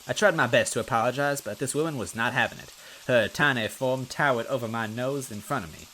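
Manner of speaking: ordinary delivery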